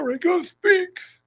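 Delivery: high slow jingle call; sing-song show announcer. Acted.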